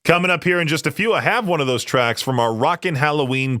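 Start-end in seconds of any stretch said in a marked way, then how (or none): none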